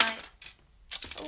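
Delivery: soft, low Australian tag; put-on Australian accent. Slipping into it by accident, light Australian accent. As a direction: toss it off in mock Australian. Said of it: "now I'm Australian".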